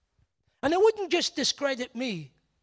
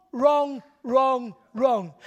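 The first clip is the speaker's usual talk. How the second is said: each "wrong" louder, stepping down in pitch; repeated wrong; 3 dB louder, much slower than usual. Real, not acted. Preacher condemning abuse, hammering each word. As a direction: hammer "wrong" three times, falling. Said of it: "repeated for emphasis".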